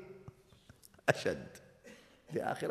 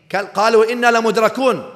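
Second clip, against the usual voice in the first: panicked cry; 10 semitones higher, 12 dB louder, much faster than usual. Acted. Frightened people cry out.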